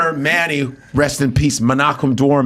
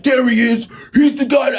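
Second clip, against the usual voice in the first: pointing out the guy; 9 semitones higher than usual. Retold or imitated. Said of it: "They're like".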